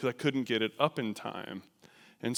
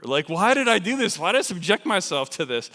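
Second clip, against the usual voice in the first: higher, louder exasperated self-questioning; loud, high-pitched "why me" complaint; 5 semitones higher, 6 dB louder than usual. Retold or imitated. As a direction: wail it in mock regret, raised voice. Exasperated thought at worst present.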